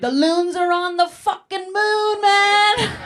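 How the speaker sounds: loud, high, slow sing-song stoner shout; tripping ecstatic yell. Pretend. Comic imagining a heavy mushroom trip, ecstatic sung shout. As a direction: howl it like you're tripping hard.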